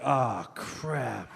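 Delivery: low, soft mock dismay; quietly deflated stoner; dismayed pothead. Comic as pothead finding the car gone, dismayed.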